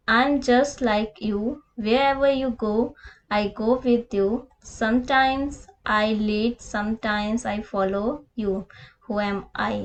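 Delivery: very slow, spaced classroom reading; patient read-aloud for pupils; slow riddle reading. Shown as a demonstration. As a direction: dictate the riddle line by line. Teacher reads last riddle aloud.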